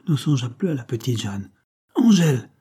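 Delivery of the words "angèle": sharp high startled cry of her name; husband crying out his wife's name in shock. A narrator's reading. Startled loud cry. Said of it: "s'écria-t-il".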